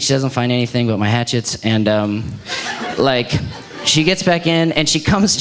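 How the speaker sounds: usual speaking voice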